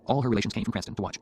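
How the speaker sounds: ordinary delivery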